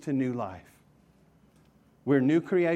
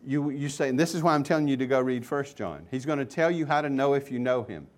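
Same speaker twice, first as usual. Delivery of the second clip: ordinary delivery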